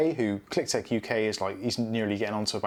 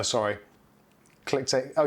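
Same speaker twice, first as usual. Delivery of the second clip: ordinary delivery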